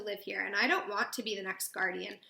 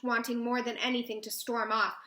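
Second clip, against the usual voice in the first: ordinary delivery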